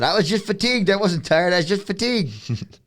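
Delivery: high-pitched mock protest; put-on high voice making excuses; imitating McGregor's excuse. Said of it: "and he said".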